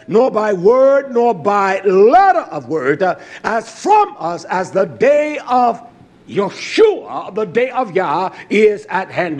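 loud, driving sermon delivery; fiery pulpit preaching; full-force preaching. Acted. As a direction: preach it out to the congregation. Preaching from Thessalonians.

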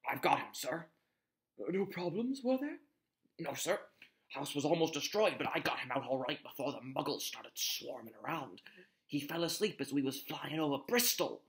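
a lightly voiced gentle giant reporting in; Hagrid voice. A narrator's reading.

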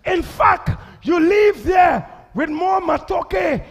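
loud, high, slow preaching. Acted. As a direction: shout it out to the church. Preaching at full force.

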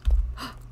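short unvoiced gasp of surprise. Real, not acted. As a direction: catch your breath at the find. Finds gold.

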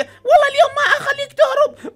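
high, loud taunting policewoman voice; villain policewoman. Acted. Player voicing the policewoman taunting escaping prisoners, high and loud. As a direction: sneer it down from way up high.

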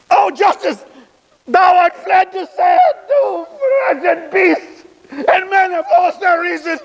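high, impassioned lament; anguished outcry. Acted. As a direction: cry out in stage grief to the heavens.

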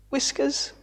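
plain reading voice

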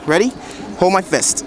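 ordinary delivery

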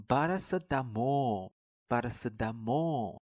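paracetamol said slowly with the stress on the last syllable mol; stress on final syllable. Shown as a demonstration. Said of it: "重读音节必须要落在最后一个音节". (paracetamol重音落在最后一个音节)

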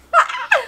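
sudden very high-pitched laugh; short squeal of laughter. Real, not acted. Bursts out after own joke.

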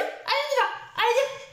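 very high, excited call to the dog; high motivating voice. Shown as a demonstration. Trainer calling Sacha to come, very high and excited. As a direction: pitch it way up to win him over. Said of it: "une voix comme ça".

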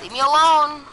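loud, high panicked yell; panicking gamer. Real, not acted. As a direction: shout it in a panic. Yells at attacking players.

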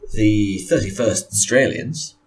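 ordinary delivery

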